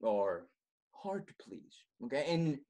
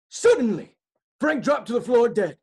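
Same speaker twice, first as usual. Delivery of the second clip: high, loud dramatic narration; dramatic reveal; 8 semitones higher, 8 dB louder than usual. Acted. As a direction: announce the death with a bang.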